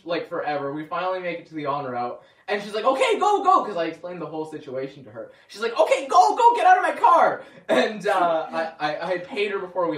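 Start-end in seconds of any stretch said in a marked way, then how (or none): 2.8-3.6 much higher, louder "go, go"; urgent shout. Retold or imitated. Old driver urging the late passenger out, high and loud. 5.8-7.4 much higher, louder "go, go"; urgent shout. Retold or imitated. Old driver urging the late passenger out, high and loud.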